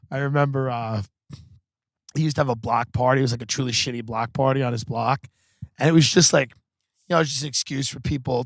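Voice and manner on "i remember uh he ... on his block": usual speaking voice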